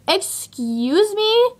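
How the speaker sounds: high, drawn-out offended question; indignant disbelief. Retold or imitated. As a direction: stretch it out, rising in disbelief. Acted indignant retort to date.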